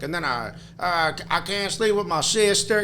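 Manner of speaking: caricatured Southern dimwit; mock Southern accent. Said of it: "we do the Southern".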